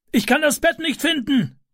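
very high, carrying call at normal volume. A narrator's reading. Neighbour calls down from the smoky room, high urgent call. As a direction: call down to those below, pitched right up. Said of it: "rief er nach unten".

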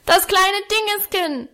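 put-on high child's tantrum yell; shrieked demand, pitched far up; bellowing little boy. A narrator's reading. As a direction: holler it like a furious toddler. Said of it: "brüllte er".

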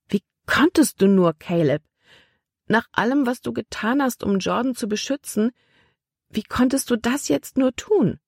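hurt and accusing, climbing high; a distressed girl's reproach rising sharply; reproachful girl. A narrator's reading. Libby accuses Caleb.

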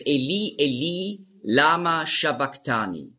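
"sabachthani" said slowly as sha-bak-tani; "lama sabachthani" slow, with sh and k in the last word; Aramaic pronunciation. Shown as a demonstration. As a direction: say "sabachthani" with initial sh and hard k. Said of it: "darum war die Aussprache ist".

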